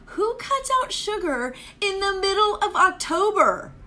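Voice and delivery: high shocked self-mockery; incredulous. Real, not acted. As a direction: squeal at the absurdity. Mock disbelief at herself.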